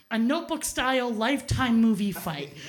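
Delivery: normal talking voice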